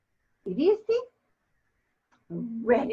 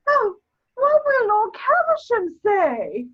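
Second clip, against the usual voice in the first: flustered dismay; 8 semitones higher, 6 dB louder than usual. Acted. Outburst of dismay.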